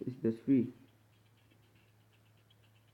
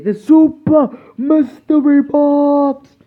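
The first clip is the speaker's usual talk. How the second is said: loud, high, slow hype voice; hyped announcer; 11 semitones higher, 15 dB louder, slower than usual. Pretend. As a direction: announce it like a game-show host. Excited announcement voice.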